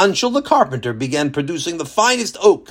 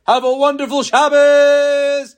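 higher, louder, slow greeting; cheerful sign-off; 11 semitones higher, 5 dB louder, slower than usual. Real, not acted. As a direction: beam out a warm farewell.

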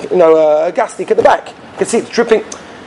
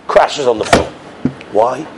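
normal talking voice

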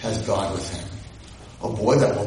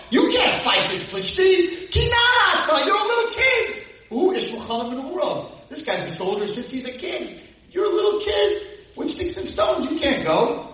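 pleading king. Retold or imitated. Shaul warning David he's just a kid, high and urgent. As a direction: beg the boy not to go, voice up high. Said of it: "And Shaul says to David".

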